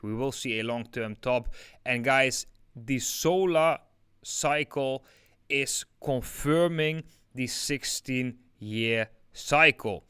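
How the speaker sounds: normal talking voice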